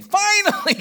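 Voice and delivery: finally, cried out high and loud; preacher bursting out with finally, loud and high; high rising shout. Acted. Preacher crying out to the congregation, loud and high.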